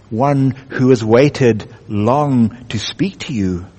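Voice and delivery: deep, slow voice of the lion in the dark; large deep voice. Acted. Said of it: "very large and deep".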